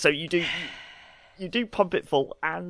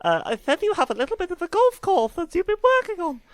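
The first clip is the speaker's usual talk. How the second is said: much higher, squeaky and bright; helium voice; 3 dB louder than usual. Pretend. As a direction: talk as if full of helium.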